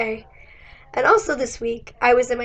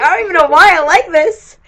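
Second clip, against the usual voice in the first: higher, louder mock bewilderment; exclaimed self-quote; 5 semitones higher, 9 dB louder than usual. Telling a friend about the balloon sweater, exclaimed bewilderment. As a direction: burst out louder in playful puzzlement. Acted quote of delighted puzzlement.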